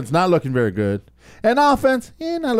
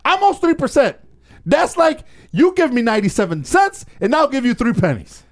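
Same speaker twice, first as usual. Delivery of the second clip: emphatic; 10 semitones higher than usual. Real, not acted. Stressing stat, raised pitch.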